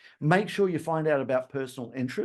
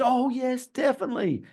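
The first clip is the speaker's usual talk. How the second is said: higher, gushing fake yes; eager customer; 10 semitones higher than usual. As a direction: oversell the yes while shaking your head. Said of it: "the customer says".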